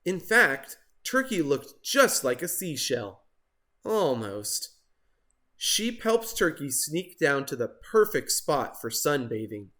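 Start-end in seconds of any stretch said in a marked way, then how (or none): none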